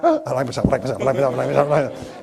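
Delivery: steady repeated self-talk after a rude shock; recovering mantra. Shown as a demonstration. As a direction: brush off the rude caller by repeating it. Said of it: "You've gotta keep yourself up".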